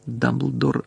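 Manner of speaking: ordinary delivery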